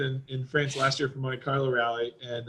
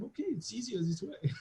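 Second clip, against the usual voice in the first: put-on high, soft French-accented voice; small high-pitched imitation French accent; French wife; 7 semitones higher, 12 dB quieter than usual. French wife agreeing with husband, high soft French accent. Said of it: "And she was like".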